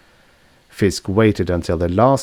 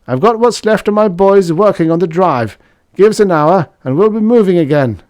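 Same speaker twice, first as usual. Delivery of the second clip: high, loud, strained voice of the big engineer; battered engineer pushing on, higher and louder; wounded ship's engineer, determined and raised up; Sandoval the engineer; 11 semitones higher, 6 dB louder than usual. Acted. Sandoval speaks through helmet.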